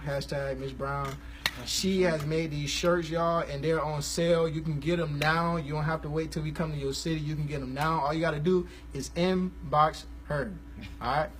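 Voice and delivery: ordinary talk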